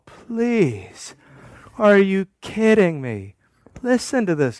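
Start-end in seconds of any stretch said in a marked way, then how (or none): none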